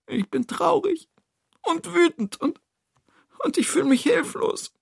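very high, tearful character voice; small and miserable. Acted. Toni confesses helplessness to friends, small and miserable. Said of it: "Toni sieht ganz klein und elend aus".

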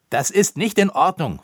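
loud, high angry protest; Koníček. A narrator's reading. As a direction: call out indignantly. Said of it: "rief er". (empört rufend)

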